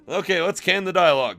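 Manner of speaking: plain reading voice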